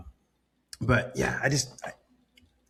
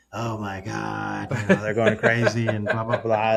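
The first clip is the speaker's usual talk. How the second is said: bad English accent, slowed mock panic; slower than usual. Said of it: "terrible English accent, sorry".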